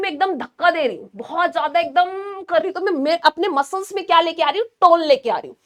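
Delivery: normal talking voice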